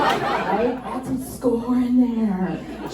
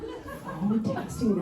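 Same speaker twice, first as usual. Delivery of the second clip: normal talking voice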